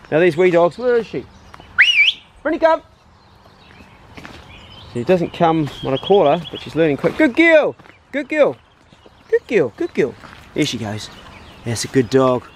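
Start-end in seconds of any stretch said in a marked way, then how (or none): 2.4-2.8 calling out. Real, not acted. Calling the dog from afar. 7.2-10.1 high, bright-toned praise for the dog; high-pitched praise. Real, not acted. Trainer praising the returning dog in a high excited voice. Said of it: "I always go in a high-pitched voice".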